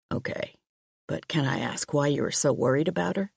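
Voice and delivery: a calm detective pressing gently. Acted. Police chief asks.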